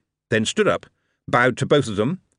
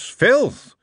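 spat in disgust; 5 dB louder than usual. Acted. Dwarf spits out hatred of goblins.

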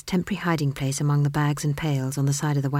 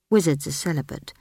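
ordinary delivery, nothing marked

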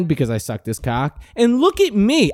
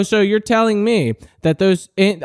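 ordinary talk, nothing marked

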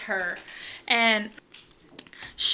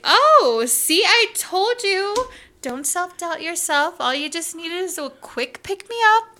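triumphant encouragement; 7 semitones higher than usual. Retold or imitated. Stylist cheers the doubting client.

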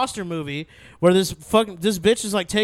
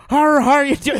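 higher, louder mock Jodie Foster voice; correcting a friend's impression with a hammed-up take; imitating Jodie Foster; 8 semitones higher, 7 dB louder than usual. Showing a friend how she really sounds, hammed-up.

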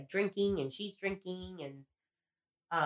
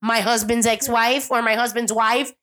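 angry quote; 5 semitones higher, 7 dB louder than usual. Retold or imitated. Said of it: "And she's like".

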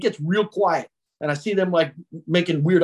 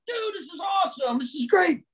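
high, thrilled but softer; mock excitement; 10 semitones higher, 5 dB quieter than usual. Jimmy greeting his buddies, imitating high excitement. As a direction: gush like a delighted kid.

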